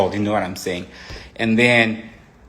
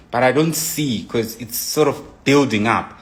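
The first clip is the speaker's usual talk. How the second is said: normal talking voice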